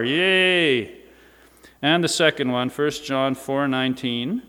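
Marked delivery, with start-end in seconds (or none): 0.0-0.9 higher, drawn-out happy yay; little cheer. Real, not acted. Preacher to congregation about being God's children, cheering. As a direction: cheer it out, stretched.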